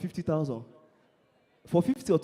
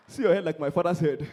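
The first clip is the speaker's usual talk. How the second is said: ordinary talk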